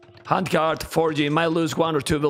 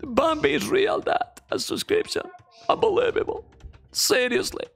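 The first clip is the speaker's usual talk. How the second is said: emotional excitement; 11 semitones higher than usual. Real, not acted. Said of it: "I'm really emotional".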